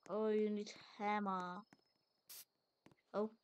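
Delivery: usual speaking voice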